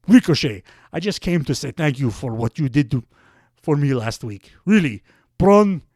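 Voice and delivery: loud put-on Russian accent, hammed-up; playful Russian accent impression of a wrestler. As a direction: bark it out in a thick mock Russian accent. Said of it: "I guess I'll just do Ruski".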